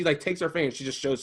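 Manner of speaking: usual speaking voice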